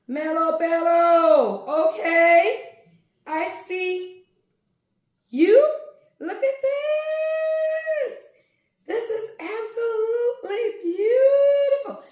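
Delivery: loud, high, near-tearful joy over the yarn; excited. Real, not acted. Unboxing yarn for viewers, crying out with high, loud delight. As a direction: gush as if overwhelmed with joy.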